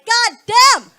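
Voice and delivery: high, loud leering exclamation; loud catcall. Retold or imitated. Guy spotting a woman from behind, loud leering shout.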